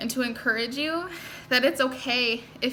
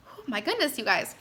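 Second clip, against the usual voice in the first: normal talking voice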